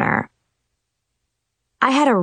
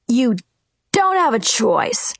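scowling; 4 semitones higher than usual. A narrator's reading. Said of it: "he scowled".

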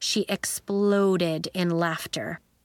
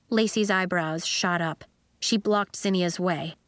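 ordinary delivery, nothing marked

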